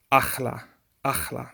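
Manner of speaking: "achla" with a scraped kh in the middle; slang pronunciation. Shown as a demonstration. Teacher quoting people's slang, rasped kh. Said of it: "people say".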